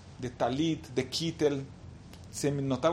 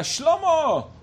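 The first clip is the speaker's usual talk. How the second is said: put-on high, raised-voice hail to an old acquaintance; excited greeting; 11 semitones higher, 6 dB louder than usual. Yehudá spots Shlomo at the bus station, excited greeting. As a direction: call out eagerly, pitched well up. Hailing a long-lost acquaintance.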